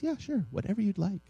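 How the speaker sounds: put-on high, easygoing child's agreement; lightly voiced child, pitched well up; retold six-year-old. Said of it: "my six-year-old".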